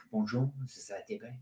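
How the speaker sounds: softly put-on French accent; hammy French accent, low-key. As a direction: play a French bank greeter. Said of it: "Mark, come back with the French".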